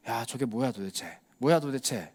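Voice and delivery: low, soft grumble of disbelief; muttered disbelief. Retold or imitated. Religious leaders eyeing the crowd, soft suspicious mutter.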